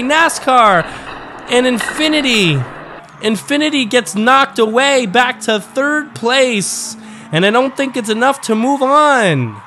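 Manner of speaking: high-pitched, excited race call at full tilt; live race play-by-play. Acted. Commentator calling a lead change at the finish, excited high play-by-play. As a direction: leap up high and punch every turn of the race.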